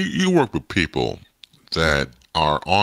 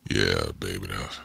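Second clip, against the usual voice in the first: low, smooth slow-jam radio voice; deep, laid-back DJ put-on; 11 semitones lower, 7 dB quieter than usual. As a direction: croon it low like a late-night love-songs host. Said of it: "slow cuts or, you know ... slow jam stuff".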